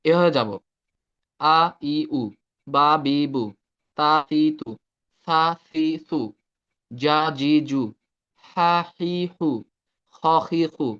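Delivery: আ ই উ, বা বি বু said as clear separate syllables; syllable drill demo. Shown as a demonstration. Teacher models the syllable drill for students, clear and measured. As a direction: spell out each letter with its vowels, one by one. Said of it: "সবার থেকে এভাবে শুনবো যে".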